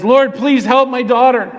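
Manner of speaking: high, imploring cry; urgent pleading. Acted. Mother runs up to Jesus for her daughter, acted urgent pleading. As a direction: beg for help with real insistence.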